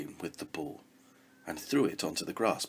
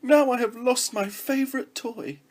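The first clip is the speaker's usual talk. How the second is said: high, louder mock wail; 10 dB louder than usual. Princess lamenting her lost toy, wailing. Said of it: "wailed the girl".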